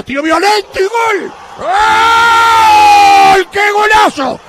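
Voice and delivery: screaming goal call, high and loud; breakneck goal commentary. Real, not acted. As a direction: roar the goal like a radio announcer.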